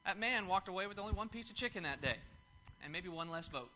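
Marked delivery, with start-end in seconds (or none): none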